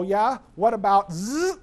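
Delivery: imitating a wife's pointed comeback; needling retort.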